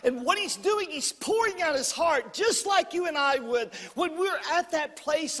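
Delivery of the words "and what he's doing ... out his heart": usual speaking voice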